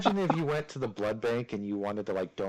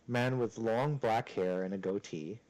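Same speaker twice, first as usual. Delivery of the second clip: usual speaking voice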